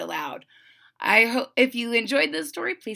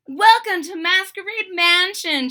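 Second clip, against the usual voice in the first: high, loud hostess greeting; grand welcome; 9 semitones higher, 8 dB louder than usual. A narrator's reading. Aura greeting her friends at the door, excitedly. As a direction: announce it to arriving guests, high and loud.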